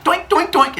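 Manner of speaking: high-pitched springy doinks; cartoon pushing sound effects; imitated poking. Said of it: "and pushes all three of them in".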